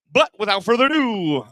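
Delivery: host hyping the next act; announcer intro. Real, not acted. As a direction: pump up the room.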